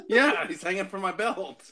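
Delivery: high-pitched, laughing through the words. Real, not acted. Player admitting to the head on his belt, laughing. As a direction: barely hold back the laughter.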